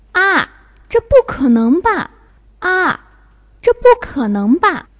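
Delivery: high, sharp falling "ah", then disbelief; surprised. Shown as a demonstration. Teacher reading quiz sentence for student, surprised. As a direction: exclaim in loud, high disbelief. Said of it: "here shows surprising".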